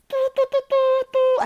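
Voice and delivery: silbido imitado, muy agudo y fuerte; imitando un silbato. Retold or imitated. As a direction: pita como un silbato de guardia. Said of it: "alguien pitando así". (imitación aguda de un pitido)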